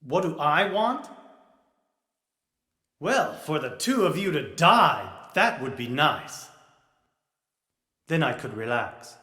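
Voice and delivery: low, understated death wish; cool menace. Acted. Wishing the two of them dead, with casual menace. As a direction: say it low and casually, dropping away softly. Third figure coolly wishes both dead.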